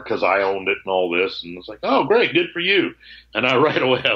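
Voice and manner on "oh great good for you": high-pitched mock congratulations; feigned cheer. Seller hiding anger from the new owner, feigning cheer. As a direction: put on a pleased voice for the buyer.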